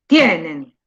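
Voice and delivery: "Tienen" with a strong TIE, falling low; stress on first syllable. Shown as a demonstration. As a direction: punch TIE and let NEN drop in "Tienen". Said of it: "decirlo bien en voz alta".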